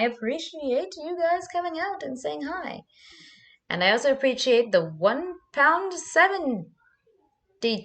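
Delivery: high, slow sing-song voice; drawn-out playful thanks. Real, not acted.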